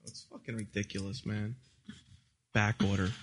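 low muttered complaint. Acted. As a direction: grumble it under the breath. Very low quiet voice.

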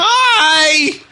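excited high greeting. Real, not acted.

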